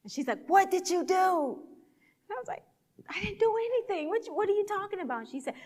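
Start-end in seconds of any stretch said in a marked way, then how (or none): 0.5-1.6 high, loud, slow accusation; accusing demand. Retold or imitated. Boss confronting summer worker, accusing demand. 3.1-5.3 high, fast, flustered denial; defensive protest. Retold or imitated. Summer worker denying blame to her boss, flustered protest.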